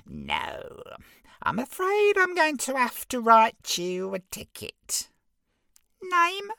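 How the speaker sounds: very high smug little imp; giggly sneering imp. Acted. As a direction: squeak it out smugly. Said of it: "the imp continued".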